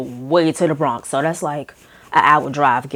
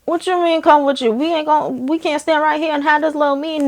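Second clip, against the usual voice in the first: much higher, incredulous pushback; indignant pushback; 10 semitones higher, faster than usual. Retold or imitated. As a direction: push back, you're not going in there. Refuses to follow the PA.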